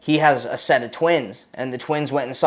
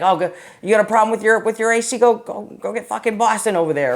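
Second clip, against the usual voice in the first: base guys calling out; 8 semitones higher, 3 dB louder than usual. Retold or imitated. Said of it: "and be like".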